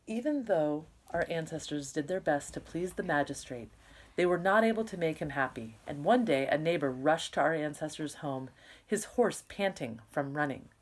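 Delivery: clear, lively read-aloud to the class; story narration. Acted. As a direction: tell the story to a room of kids. Teacher reads novel aloud.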